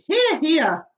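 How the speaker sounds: high, loud hearty cheer; cheering shout. Acted. Creatures cheering the ladybird's words, shouting agreement. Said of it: "they all shouted".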